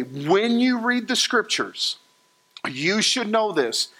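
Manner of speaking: usual speaking voice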